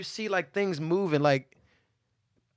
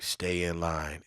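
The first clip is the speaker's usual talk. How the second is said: deep hooded guard; 11 semitones lower, 7 dB quieter, much slower than usual. Retold or imitated. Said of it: "he's like".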